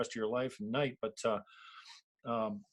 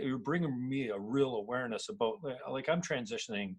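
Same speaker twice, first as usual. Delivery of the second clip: ordinary talk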